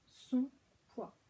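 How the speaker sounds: SON, pause, then POIDS; inverted syllables. Shown as a demonstration. Teacher showing the reversed word, son and poids said apart. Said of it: "inverser les syllabes dans le mot".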